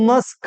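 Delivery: মস্ক in one syllable, ending on "sk"; corrected mosque. Shown as a demonstration. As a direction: say মস্ক as "mask", no "-kyu" ending. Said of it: "সঠিক উচ্চারণ কি হবে?". (মস্ক-এর সঠিক উচ্চারণ, এক দলে)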